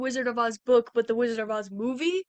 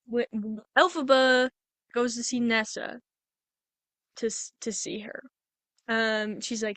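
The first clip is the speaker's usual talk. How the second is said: ordinary talk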